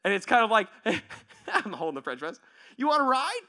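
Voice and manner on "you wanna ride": eager offer. Acted. Offers ride holding coffee press.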